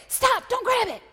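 high, loud warning cry; urgent shout. Acted. Parent warning about a hot pot, urgent shout. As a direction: yell to stop a hand in time. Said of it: "I shout the important things".

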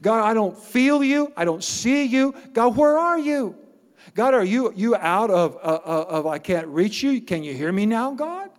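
high-pitched pleading cry to God; acted desperate prophet calling upward. Pastor as Habakkuk demanding where God is, crying out. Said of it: "cries out".

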